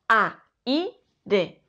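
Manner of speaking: a, i, de as three separate syllables; choppy a, i, de; wrong split. Shown as a demonstration. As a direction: break aire wrongly into a - i - de. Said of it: "No decimos".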